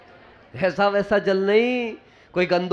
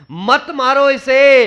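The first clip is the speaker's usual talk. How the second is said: loud, slow plea to spare the snake; urgent cry to hold back the sword; pleading shout; 5 semitones higher, 5 dB louder, slower than usual. Acted. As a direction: beg him to stop, slow and raised. Daughter begs him not to kill.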